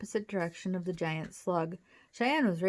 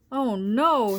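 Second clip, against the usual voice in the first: loud, raised "oh no"; cry of alarm; 4 semitones higher, 8 dB louder than usual. A narrator's reading. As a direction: cry out in dismay. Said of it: "Cheyenne cried".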